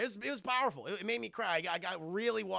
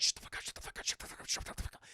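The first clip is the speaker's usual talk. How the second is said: rapid, repeated whisper; retold panicked whisper; frantic hushing; 4 dB quieter, much faster than usual. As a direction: whisper urgently to quiet a baby. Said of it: "Just trying to get the baby shut".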